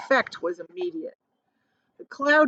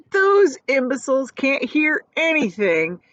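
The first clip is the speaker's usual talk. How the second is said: loud scoff; 5 semitones higher, 5 dB louder than usual. A narrator's reading. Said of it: "he cried".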